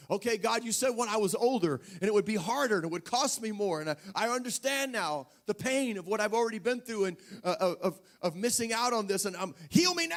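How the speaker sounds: preaching-force prayer for healing; fervent prayer. Retold or imitated. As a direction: cry out to God insistently.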